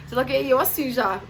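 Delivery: usual speaking voice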